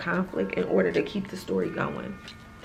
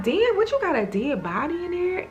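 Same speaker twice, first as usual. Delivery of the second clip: high, teasing disbelief; mock-shocked Cheeks; 9 semitones higher than usual. Retelling a scene, Cheeks teases the girl in a high mock-shocked voice. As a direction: poke fun at what's in the trunk.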